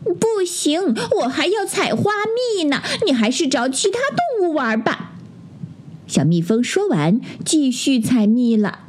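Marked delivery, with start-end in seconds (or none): 0.1-5.1 high, small bee voice; squeaky-high refusal from a busy bee; busy little bee. A narrator's reading. Busy bee turns the little bear away, high-voiced brush-off.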